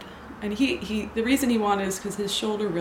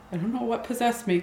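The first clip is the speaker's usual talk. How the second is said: normal talking voice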